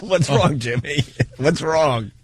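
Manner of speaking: asked through real laughter; can barely get the words out for laughing; giggling question. Real, not acted. Laughing at the tape.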